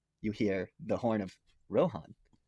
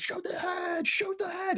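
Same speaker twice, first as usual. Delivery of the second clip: very high, strained-back shout at usual volume; imitated battlefield yell; much slower than usual. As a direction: mimic a commander calling over the battle. Acts Theoden yelling orders in battle.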